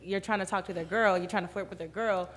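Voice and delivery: ordinary talk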